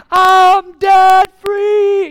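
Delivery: long, high, full-throated scream of joy; Dave Ramsey debt-free scream. Retold or imitated. Preacher imitating a freed servant's debt-free scream. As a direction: scream it out like you just paid off everything. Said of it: "doing the Dave Ramsey debt-free scream".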